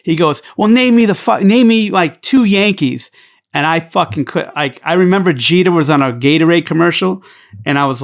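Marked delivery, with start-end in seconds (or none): 0.6-3.1 a Yankee fan jeering at a Red Sox suspect; mock hostile challenge. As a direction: bark it out like a jeering rival fan.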